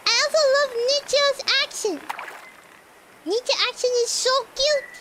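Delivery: high, quick, adoring gush; excited gushing. Real, not acted. Streamer loving Nicho's accent, excited gushing.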